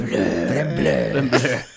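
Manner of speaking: Flamblade in a light mock French accent. Shown as a demonstration. Husband defends how he says it, mock French accent. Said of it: "I'm saying it in a French accent".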